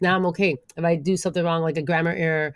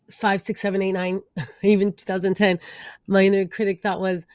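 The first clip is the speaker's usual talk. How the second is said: ordinary talk, nothing marked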